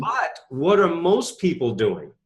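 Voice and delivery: ordinary talk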